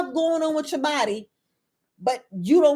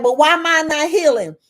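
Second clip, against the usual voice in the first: loud, higher-pitched mock complaint; mimicked complaint; 4 semitones higher, 7 dB louder than usual. Followers questioning why they are not healing, loud mimicked complaint. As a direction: imitate a follower protesting loudly.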